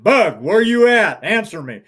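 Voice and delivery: a father's stern shout down the canyon; sternly. Retold or imitated. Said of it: "even more sternly".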